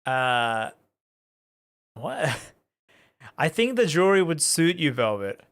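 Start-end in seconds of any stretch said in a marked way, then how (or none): none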